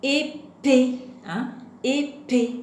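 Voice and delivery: Épée slowly split as É, PÉE, with a gap between; split into syllables. Shown as a demonstration. Teacher points out the second syllable, épée broken in two. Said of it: "c'est dans la deuxième syllabe".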